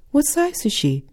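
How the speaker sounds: chirpy saleswoman. Retold or imitated. Bra saleswoman asks brightly.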